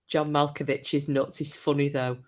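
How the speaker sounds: normal talking voice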